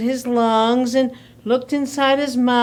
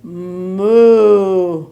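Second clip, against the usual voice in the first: long, low, loud moo; deep drawn-out cow call; 5 semitones lower, 5 dB louder than usual. Acted. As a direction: bellow like a cow. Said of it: "And he went".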